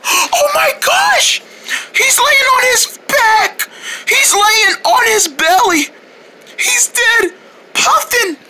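very high, hammed-up panic; panicked alarm. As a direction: cry out in shock over a fallen friend. Friends think the puffin died.

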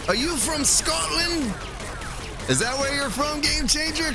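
high-pitched put-on Scottish accent. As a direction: ask in a playful, high Scottish lilt. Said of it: "talk with a Scottish accent".

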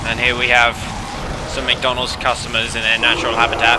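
nature documentary. Pretend.

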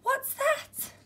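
high-pitched startled wondering; high, nervous storybook gasp of a question. A narrator's reading. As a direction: spot something scary in the dark. Eddie spots something in dark wood.

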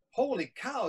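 high-pitched exclamation; storyteller acting a startled boy; amazed boy. Boy sees the sea vanish.

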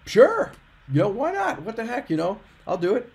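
high, upbeat agreement; retold eager acceptance. Applicant retelling his answer to interviewers, eager and game. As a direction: say yes readily, voice lifting high. Retelling easy yes to job offer.

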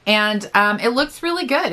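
normal talking voice

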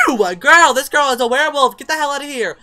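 high, very loud outburst; disgusted shriek. Real, not acted. Player ordering a werewolf Sim out, disgusted shouting. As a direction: shriek in disgust and chase them off.

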